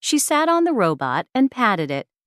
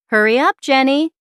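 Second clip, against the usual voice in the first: loud, higher hurry-up call; 6 semitones higher, 6 dB louder than usual. Acted. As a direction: call up the stairs to hurry her. Mom hurrying Jenny to school.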